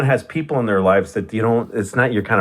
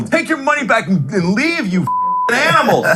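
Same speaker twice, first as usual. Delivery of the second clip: mock furious yell; 12 semitones higher than usual. Retelling the comic screaming.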